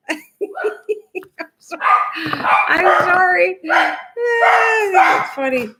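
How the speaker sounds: high, giggly sorry through laughter; can't stop laughing while apologizing; laughing apology. Real, not acted. Apologizing to viewers while laughing at the dogs.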